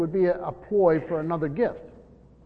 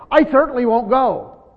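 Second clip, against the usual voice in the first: loud, raised, emphatic "certainly won't go"; very strong negative; 5 semitones higher, 7 dB louder than usual. Shown as a demonstration. Preacher showing both Greek negatives used together, forceful refusal. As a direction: refuse outright, driving "certainly won't" hard and high. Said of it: "that's very strong negative".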